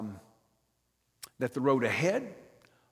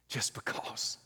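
breathy whispered echo, slow; soft whispered repeat; 9 dB quieter than usual. Shown as a demonstration. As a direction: whisper it back to yourself. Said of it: "just because he's the Lord".

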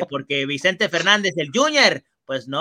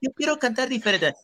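pleading son voice; 9 semitones higher than usual. Retold or imitated. Son voice continues after laugh.

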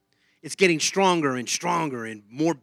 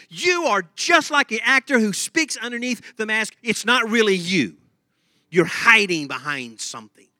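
higher, louder accusing preacher voice; Jesus confronting hypocrites; 5 semitones higher, 7 dB louder than usual. Retold or imitated. Preacher voicing Jesus accusing the leaders, loud. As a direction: call them out from under the mask. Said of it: "And Jesus is saying".

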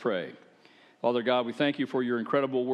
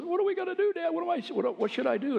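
much higher, frantic pleading voice; a rattled young man begging dad for help; panicked son; faster than usual. Retold or imitated. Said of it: "he was all shook up".

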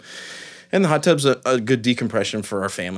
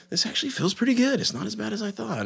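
surprised relief; 10 semitones higher, 5 dB quieter than usual. Retold or imitated. Inner voice settling into ice.

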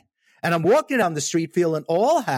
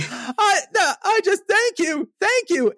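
much higher, tearful imitation of an old lady; imitating crying thanks; 3 dB louder, slower than usual. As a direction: choke out the thanks like a weeping grandmother. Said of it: "She starts breaking down crying, going".

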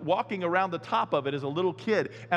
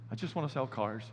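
softer, deflated; quiet sigh; 4 semitones lower, 7 dB quieter than usual. Retold or imitated. Pastor finishing his plea to God, quiet and deflated. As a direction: let it drop to a defeated murmur. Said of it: "it's like".

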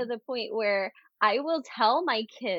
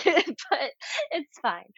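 laughing it off nervously; 7 semitones higher, much slower than usual. Real, not acted. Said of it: "that's like a nervous laugh".

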